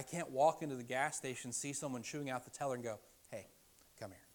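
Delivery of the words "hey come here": soft, low coaxing; hushed, lower-pitched invitation; mock tender beckoning. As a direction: quietly coax as if consoling someone. Imagined beckoning of a stranger.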